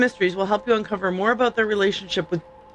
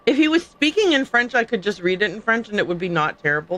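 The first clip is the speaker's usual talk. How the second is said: ordinary talk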